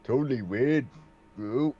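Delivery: laid-back surfer bro Ninja Turtle. Acted. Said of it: "my Ninja Turtle voice".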